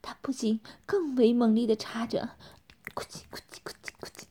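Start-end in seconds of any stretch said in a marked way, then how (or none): 2.9-4.2 fast, hushed squelching noise; imitated squelch. A narrator's reading. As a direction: rattle off the sound very softly. (轻声快速拟声)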